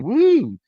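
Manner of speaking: high-pitched joking "woo"; mock excited whoop. Co-host joking about the ladder war name, mock whoop. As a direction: whoop it up as a joke.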